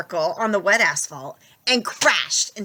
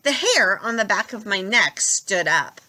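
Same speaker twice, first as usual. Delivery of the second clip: ordinary delivery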